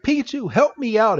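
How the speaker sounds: high-pitched acted appeal; mock plea for help. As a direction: call out as if in trouble. Ash calls Pikachu for help.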